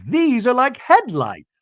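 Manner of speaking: high, drawn-out mock amazement; Bronwyn, gushing. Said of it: "Bronwyn goes".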